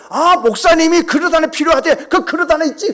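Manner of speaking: high, loud mock busybody delegating; imitated Seoul-Gyeonggi person. Seoul churchgoer passing the pastor's errand to a deacon, high loud imitation. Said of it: "서울 경기 사람은 어떻게 하느냐".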